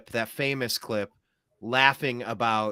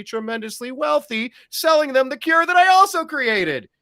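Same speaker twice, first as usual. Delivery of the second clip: mock-innocent excuse; 10 semitones higher than usual. Mock excuse of lab-leak culprit.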